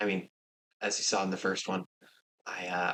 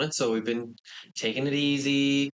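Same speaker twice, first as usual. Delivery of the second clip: usual speaking voice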